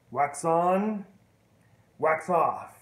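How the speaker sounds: wax on, wax off called out loud. Retold or imitated. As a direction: call the drill out firmly. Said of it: "He goes".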